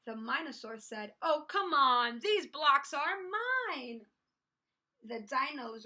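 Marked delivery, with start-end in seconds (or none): 1.2-4.1 loud protest, slowing for emphasis; exasperated insistence. A narrator's reading. Minosaur claiming the blocks, exasperated. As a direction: argue back stubbornly. Dinosaur argues over the blocks.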